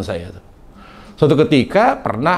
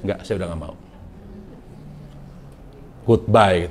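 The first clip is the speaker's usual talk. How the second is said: lower, much softer final refusal; cold refusal; 6 semitones lower, 6 dB quieter than usual. Retold or imitated. Wife quietly refusing her crying husband. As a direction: quietly shut the door on him. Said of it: "gitu dia".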